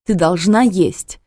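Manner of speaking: loud, slow, anxious cry; nervous exclamation. A narrator's reading. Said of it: "нервно воскликнула Алиса".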